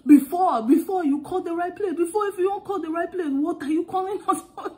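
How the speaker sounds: put-on loud, high, scolding African accent. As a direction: rattle off an exasperated rant in African English. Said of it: "I started speaking African".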